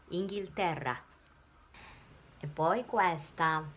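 usual speaking voice

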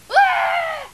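loud, high startled yell; high-pitched scream. Acted. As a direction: scream out as the ride goes wrong. Cries out during a bumpy ride.

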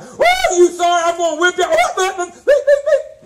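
very high loud laughing mock rant with garbled beeps; mock furious aunt. Preacher imitating his furious great aunt scolding, shrieking mock rant. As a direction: rage like the mad old aunt. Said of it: "she could talk that French".

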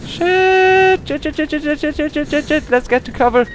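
very high, loud string of repeated yelps; panicked yelping. Real, not acted. Gamer fleeing a demon to cover, panicked squeal. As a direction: squeal it in panic while scrambling for safety.

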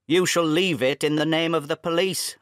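loud, enraged threat; half mad, hurl it at him; father threatening his son with police; grief and rage. Acted. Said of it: "I cried, half mad with grief and rage".

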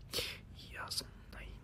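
whispered and fast. Real, not acted. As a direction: breathe it out fast. Worried aside under his breath.

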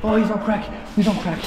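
high, frantic cries while fleeing; real panic. Real, not acted. Fleeing an attacker with a hurt leg, panicked cries. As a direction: gasp it out in alarm.

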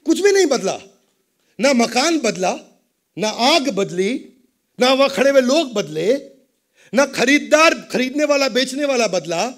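high, loud rhetorical preaching; preacher loudly asks what really changed; forceful preaching. Acted. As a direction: hammer the point home to the crowd. Preacher drives home point.

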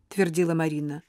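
plain reading voice

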